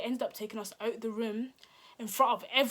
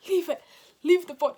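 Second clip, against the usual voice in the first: high, loud urgent order; urgent urging; 8 semitones higher, 11 dB louder than usual. Retold or imitated. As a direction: act out a friend insisting loudly. Friend urging her to go.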